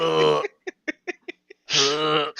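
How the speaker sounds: low, repeated groans of disgust; low queasy "ugh". Real, not acted. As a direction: groan low, still grossed out. Still disgusted by the sock joke.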